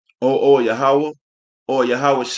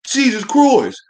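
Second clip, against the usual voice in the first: high, louder "Jesus Christ" thrown out as a curse; mock exclamation; 9 semitones higher, 4 dB louder, slower than usual. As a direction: blurt "Jesus Christ" like someone swearing carelessly. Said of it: "called him damn God and Jesus".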